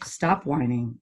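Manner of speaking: ordinary talk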